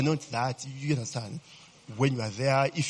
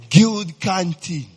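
"Guild canteen" stretched slow and louder; drawn-out nostalgic call; 3 semitones higher, 8 dB louder, much slower than usual. Real, not acted. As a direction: savour "Guild canteen" like a fond memory. Fond nostalgic exclamation.

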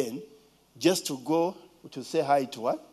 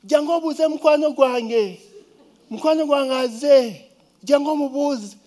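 high, loud excited call to the maids; high, loud excited little girl; excited child calling; 8 semitones higher, 6 dB louder than usual. Retold or imitated. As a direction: call the maids, thrilled a friend came. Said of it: "he said".

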